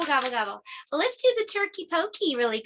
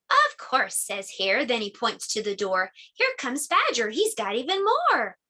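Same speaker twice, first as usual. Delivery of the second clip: put-on reading to children; 2 semitones lower than usual. A narrator's reading. Teacher reading Bear Says Thanks to the class. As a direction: read it gently to little listeners. Reads picture book to children.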